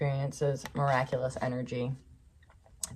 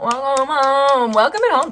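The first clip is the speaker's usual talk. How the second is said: joyous welcome; 11 semitones higher, 11 dB louder than usual. Acted. Welcoming the listener's returned aspect home, with a jubilant cheer. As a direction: call it out like throwing open the door for a party. Joyful welcome, then "throw a party.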